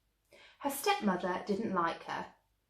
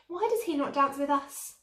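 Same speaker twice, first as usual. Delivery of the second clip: jealous complaint; 6 semitones higher, 5 dB louder than usual. A narrator's reading. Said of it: "they cried".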